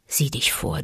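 a quiet, dangerous warning; low menacing warning. Acted. Mother Wolf warning the tiger, quiet menace.

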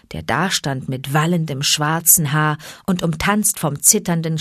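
ordinary delivery

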